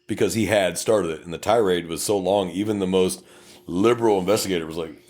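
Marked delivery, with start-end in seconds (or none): none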